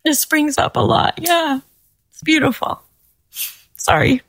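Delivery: high, choked-up voice; apologetic, overcome by memories; emotional. Real, not acted. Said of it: "I'm emotional now".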